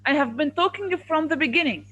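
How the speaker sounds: loud, insistent teacher; real classroom telling-off; exasperated scolding. Real, not acted. Teacher scolds, then quizzes another pupil.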